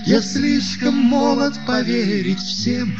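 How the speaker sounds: ordinary talk, nothing marked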